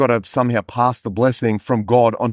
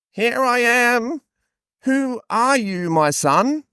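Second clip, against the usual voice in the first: high, slow, drawn-out call; hammed-up Bible father; old father's character voice; 11 semitones higher, slower than usual. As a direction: stretch it out high like a stagey patriarch. Voices blind Isaac answering.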